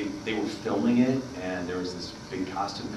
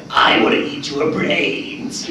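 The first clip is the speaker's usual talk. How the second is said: high, slow, monster toy voice; Venom toy voice; 9 semitones higher, 10 dB louder, much slower than usual. Retold or imitated. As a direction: drawl it like a creepy talking toy. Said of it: "there's a little recorder that would say".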